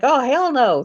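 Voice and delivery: high, loud imitated "oh hell no" from the scared delivery man; a startled man bolting from a barking dog, high and loud; mock alarmed yelp. Retelling scared delivery guy.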